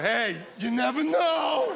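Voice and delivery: slow, teasing ad voice with a laugh; mock ad pitch. Comic doing the lottery slogan, slow and teasing. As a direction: drag it out like a sly lottery ad.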